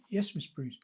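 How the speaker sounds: small, soft sheepish reply. Retold or imitated. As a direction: answer meekly, like someone caught out. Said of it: "So I sheepishly go".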